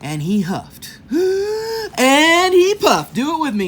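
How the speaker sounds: high, loud, very slow blowing build; hammed-up huff and puff for kids; dramatic huffing narration. A narrator's reading. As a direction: blow the words out like wind.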